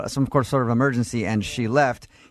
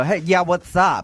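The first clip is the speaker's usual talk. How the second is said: higher, louder mock greeting; put-on phone prank voice; 5 semitones higher, 4 dB louder than usual. Host joking about how the caller was expected to answer, put-on prank-call voice. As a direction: put on a higher, punchier character voice.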